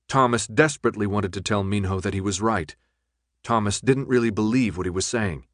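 usual speaking voice

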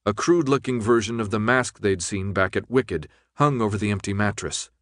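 plain reading voice

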